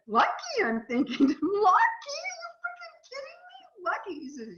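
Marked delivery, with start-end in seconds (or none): 0.1-0.6 high, incredulous "Lucky" with a rising tune; mock-outraged disbelief at the word "Lucky"; indignant. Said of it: "I'm, like, indignant". 1.4-4.1 much higher, incredulous retort; indignant disbelief. Retold or imitated. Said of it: "I'm, like, indignant".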